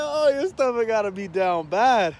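laughing through a mock sympathetic line; laughing quote. Teasing a friend about his stomach, laughing. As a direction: crack up while teasing. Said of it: "I was like".

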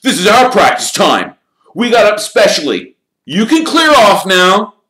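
loud, high angry order; bellowing. A narrator's reading. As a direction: bellow the rival team off the field. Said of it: "Wood bellowed at the Slytherin captain".

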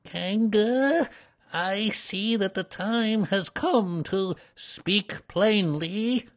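high, slow small-animal voice; high, deliberate show of courage; trying to be brave. A narrator's reading. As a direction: declare it slowly, as bravely as you can. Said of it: "in as brave a voice as he could".

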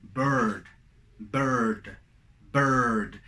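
"Bird" with a central er, near eh. Shown as a demonstration. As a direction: hold the er in "Bird", then a final d. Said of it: "Es como una E". ("Bird" como una E con la boca distinta)

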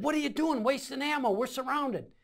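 high, agitated retold scolding; alarmed protest. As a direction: imitate a medic protesting in alarm.

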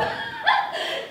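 loud, very high giggle. Acted. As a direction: let out a shrill nervous giggle. Jane laughs after ice cream.

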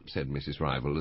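ordinary delivery, nothing marked

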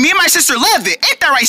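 ordinary talk

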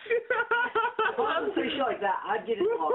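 high-pitched real laughter breaking up the words; laughing hard. Real, not acted. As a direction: push the sentence out through laughter. Cracking up at parents joke.

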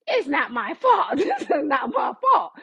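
slow, hammed-up insistence breaking into laughter; mock-aggrieved protest. Hagar protesting to God, hammed-up grievance.

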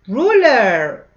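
high, loud excited call; teacher cheering loudly as she feeds the monster. Pretend. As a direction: cheer as the ruler goes in. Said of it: "feeding the monster, excited".

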